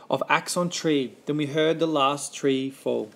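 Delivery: ordinary delivery, nothing marked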